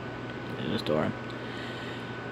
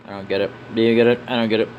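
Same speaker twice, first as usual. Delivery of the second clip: ordinary delivery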